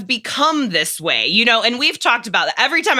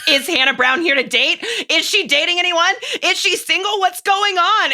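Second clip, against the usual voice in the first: higher and higher, frantic string of questions; mock frantic gossip; 9 semitones higher than usual. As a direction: rattle off the questions in mounting alarm. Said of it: "They're like".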